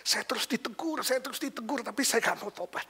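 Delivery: high-pitched voice of a distressed woman, retold; mock-tearful high voice; imitated tearful confession. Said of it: "Dia nangis lebih kencang lagi".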